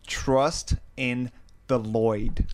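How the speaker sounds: "Lloyd" read slowly and deliberately; slow solemn "Lloyd"; slow title read. Retold or imitated. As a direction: spell out "Trust in the Lloyd" slowly. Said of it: "it just says".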